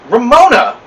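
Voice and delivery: loud, high horrified cry. A narrator's reading. Beezus horrified at Ramona showing her scab. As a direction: call her name in shock. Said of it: "Beezus was horrified".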